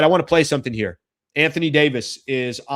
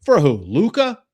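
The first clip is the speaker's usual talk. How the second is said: louder, higher disbelieving question; incredulous for who; 4 semitones higher, 7 dB louder than usual. Retold or imitated. Imitating AD, stunned and louder. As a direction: AD can't believe who he's traded for. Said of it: "He's like".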